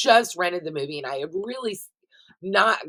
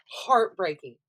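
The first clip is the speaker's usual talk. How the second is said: ordinary delivery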